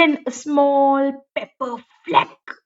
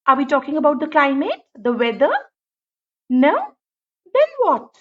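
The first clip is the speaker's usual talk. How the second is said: ordinary delivery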